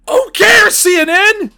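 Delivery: high, loud exasperated yell at the media; furious shout. Real, not acted. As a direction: throw it at CNN at full volume. Peak of rant.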